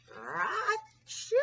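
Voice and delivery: loud, high mock sneeze; big sneeze. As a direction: burst out in a sneeze. Bear sneezes.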